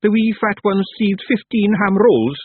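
high Feagle voice, savouring it slowly; admiring. A narrator's reading. Rob reports a witch's thieving, admiringly. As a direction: marvel at an impressive theft. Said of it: "he added admiringly".

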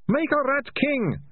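very high, drawn-out confession; bursting out. A narrator's reading. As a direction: blurt it out in a panicked high wail. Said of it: "Ratcatcher two burst out".

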